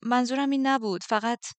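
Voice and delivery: flustered hurried apology. Acted. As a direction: rush to take it back. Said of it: "با عجله گفتم".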